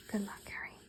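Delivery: soft whispered murmur. A narrator's reading. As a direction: murmur it under your breath. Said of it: "he murmured".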